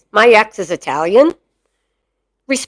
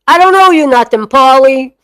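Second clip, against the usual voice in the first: baba taklidi gibi: daddy impression; 2 semitones higher, 4 dB louder than usual. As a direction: play your daddy, pitched up and fast. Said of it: "make me look like my daddy".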